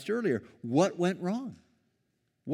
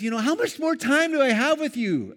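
exasperated Jesus; 13 semitones higher, 8 dB louder than usual. Retold or imitated. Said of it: "he basically says".

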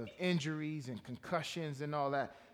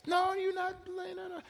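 hammed-up protective mom digging in; imitating stubborn mother.

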